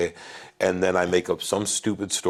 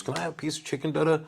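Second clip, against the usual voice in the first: put-on high, soft sweet little girl; 9 semitones higher, 5 dB quieter, faster than usual. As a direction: ask sweetly like a small daughter. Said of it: "And she goes".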